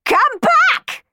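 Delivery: very high, loud screech. Acted. Miss Baggett chasing the sweeps, a loud high screech. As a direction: screech after them furiously. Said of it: "screeched Miss Baggett".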